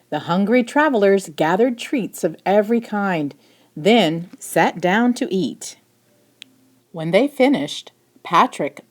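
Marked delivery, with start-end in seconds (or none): none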